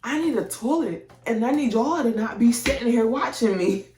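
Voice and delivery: refusing the nurse, voice rising; retold protest. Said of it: "I said".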